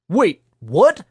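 high, loud, disbelieving squawk; incredulous yelp of a question; startled squawk. A narrator's reading. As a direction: squawk it in surprise, pitch jumping up. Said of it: "Thomas squawked".

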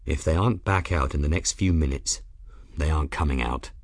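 low, frank, matter-of-fact. A narrator's reading. Captain telling his crewman the hard truth, frank tone. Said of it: "said in a frank tone".

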